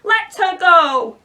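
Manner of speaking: loud, slow commanding roar; Hook roar. Acted. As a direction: bellow like the pirate captain. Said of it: "Peter roared, still sounding like Hook".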